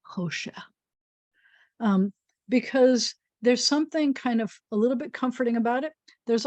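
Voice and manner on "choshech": Choshech soft and smooth as velvet. Shown as a demonstration. Teacher showing class how velvety Choshech sounds. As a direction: murmur Choshech gently. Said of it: "It also sounds to me like velvet".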